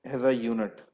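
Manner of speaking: very slow, word by word, long pauses; slow dictation. Shown as a demonstration. Teacher dictating a definition to students, slow and spaced. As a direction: dictate it so students can write.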